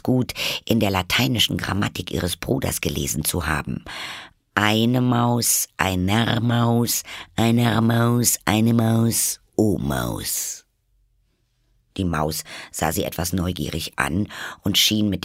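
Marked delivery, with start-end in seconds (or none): 4.5-10.7 slow, higher chant on a level tone; reciting declensions. A narrator's reading. Alice recalls her brother's Latin grammar, reciting declensions.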